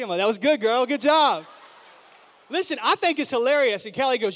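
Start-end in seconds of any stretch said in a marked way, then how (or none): 0.2-1.4 loud proud dad cheering his daughter; delighted praise. Retold or imitated. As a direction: hype her up like a proud dad.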